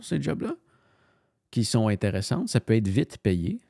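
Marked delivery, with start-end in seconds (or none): none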